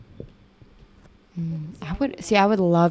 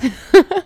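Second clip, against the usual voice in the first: squealing laugh; 14 semitones higher, 10 dB louder than usual. Real, not acted. Delighted laugh after compliment.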